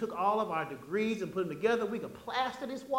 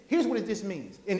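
ordinary talk, nothing marked

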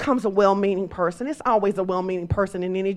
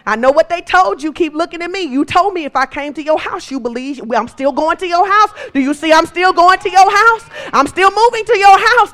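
much higher and louder, slowing into the last line; rising preaching; 8 semitones higher, 7 dB louder than usual. Acted. Jesus urging Jairus on, much higher and louder. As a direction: build urgency to the climax.

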